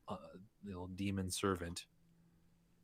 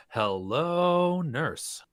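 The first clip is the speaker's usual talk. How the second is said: high, loud leering greeting; flirty hello; 9 semitones higher, 9 dB louder, much slower than usual. Acted. Player character hails the alchemist with a flirty call. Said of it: "sees her, and goes".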